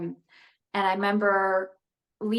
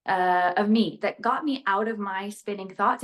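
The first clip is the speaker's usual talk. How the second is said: ordinary delivery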